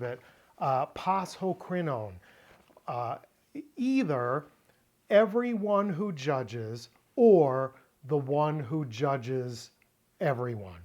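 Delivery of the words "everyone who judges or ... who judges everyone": ordinary delivery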